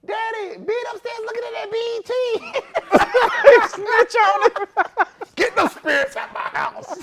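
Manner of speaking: high, loud tattletale voice; high, loud mocking little sister; snitching little daughter. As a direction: tattle loudly on your brother. Daughter snitching, dad scolding.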